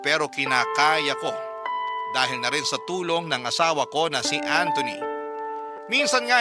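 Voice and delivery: usual speaking voice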